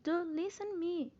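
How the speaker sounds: high, slow, insistent "Do listen me"; deliberate, pressing command demo; emphatic imperative. Shown as a demonstration. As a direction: raise and lengthen "Do listen" for emphasis. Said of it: "pra dar mais ênfase".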